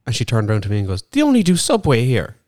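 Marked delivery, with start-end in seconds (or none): 1.1-2.4 high, puzzled imitation of the woman; confused customer voice. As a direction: play a baffled customer, pitched up. Retelling confused customer at Subway.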